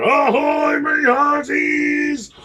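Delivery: booming high pirate hail, the middle word stretched slowly. Real, not acted. Pirate captain greeting the judges, booming.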